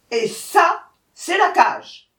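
loud, high, punched-out pointing; furious tiger. A narrator's reading. Tiger showing the cage to the jackal, furious. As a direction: bark it out with mounting force. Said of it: "le tigre furieux".

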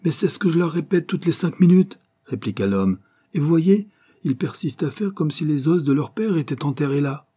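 high-pitched, aggrieved retort; exasperated protest. A narrator's reading. Foreman answers his yelling boss about the diggers, exasperated and high.

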